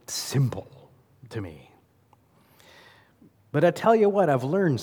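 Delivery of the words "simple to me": whispered aside. Real, not acted. Mocking how Southerners sound.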